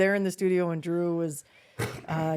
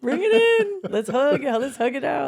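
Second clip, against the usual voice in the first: much higher and louder beaming shout; joyful hug call; 13 semitones higher, 10 dB louder than usual. Retold or imitated. Dad calling his daughter in for a hug, loud and elated. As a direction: open your arms and call her in.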